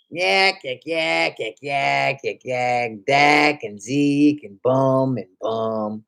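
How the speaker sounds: a hint of mocking yak yak yak, slowing down; mock fan chatter. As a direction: lightly parody annoying fans' babble. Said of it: "y'all annoying voices".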